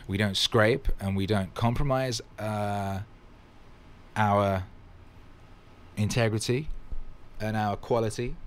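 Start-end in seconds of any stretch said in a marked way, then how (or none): none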